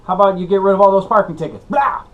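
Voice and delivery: mock taunt. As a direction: rub it in the judge's face. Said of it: "And then you just go".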